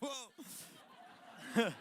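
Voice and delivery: startled whoa. Real, not acted. Startled by a noise.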